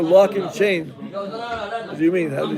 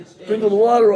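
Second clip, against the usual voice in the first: "sprinkle the water" higher and louder, falling firmly on "water"; excited confirmation; 4 semitones higher, 7 dB louder than usual. Acted. Maria proudly telling the priest she did it, excited.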